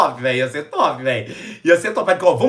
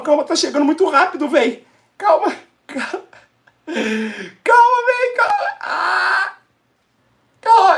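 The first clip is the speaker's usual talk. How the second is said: higher and higher, panicky "calma"; frantic excitement; 11 semitones higher than usual. Real, not acted. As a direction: barely hold it together as it rises. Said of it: "Ai, calma". ("calma" cada vez mais agudo e aflito)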